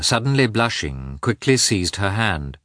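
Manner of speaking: plain reading voice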